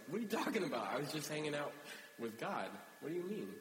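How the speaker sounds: high-pitched mock bewilderment; retold baffled protest, voice jumping up. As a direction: act out an incredulous, innocent reply. Acted Moses, baffled by the crowd.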